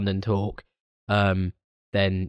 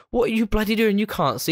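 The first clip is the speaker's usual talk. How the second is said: high-pitched outburst; 11 semitones higher than usual. Retold or imitated. Acting out film scene.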